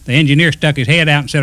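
plain reading voice

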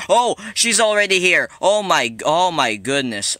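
high, loud cry, easing off; startled alarm. Real, not acted. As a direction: yelp as she shows up early. Granny suddenly appears.

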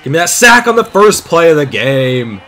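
loud, high hype yell; excited shout. Real, not acted. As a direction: shout at the screen. Yelling at game play.

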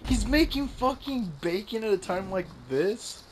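high, drawn-out disbelief; incredulous outrage. Real, not acted. Outraged that friend is cooking mid-game.

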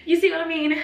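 high, loud tearful plea; crying out the question. Real, not acted. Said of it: "ignore the fact that I'm crying".

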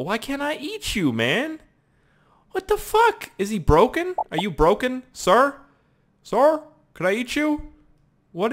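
very high, incredulous questioning; baffled. Real, not acted. Gamer to a player he cannot eat, high bafflement. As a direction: squeal the questions in disbelief.

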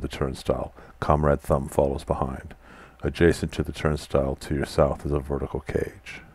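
steady storyteller reading of game text; story narration. A narrator's reading. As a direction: read the scene out like a narrator. Reads the game's room text.

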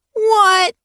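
very high, loud protest; dismayed exclamation. A narrator's reading. Apprentice told to stay in camp, dismayed. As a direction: exclaim in high-pitched disbelief.

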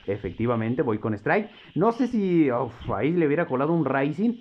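usual speaking voice

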